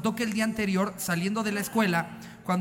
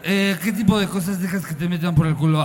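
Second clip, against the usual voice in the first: put-on louder, laughing sleazy drunk; town drunk; 2 semitones lower, 5 dB louder than usual. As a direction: play the pushy drunk through laughs. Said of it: "imitando a un borracho acosador e imprudente".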